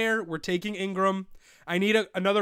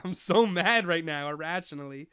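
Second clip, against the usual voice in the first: usual speaking voice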